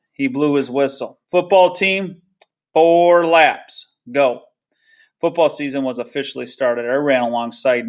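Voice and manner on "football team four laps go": raised, higher coach's command; coach's call. A narrator's reading. Coach starting football practice, calling out the laps. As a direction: call out orders to the squad. Coach shouting orders to team.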